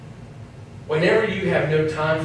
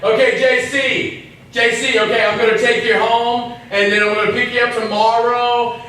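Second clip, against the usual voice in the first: play-scolding raised voice; mock yell; 6 semitones higher, 4 dB louder than usual. Preacher mock-yells at distracted J C on his phone. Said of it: "I'm yelling at J C".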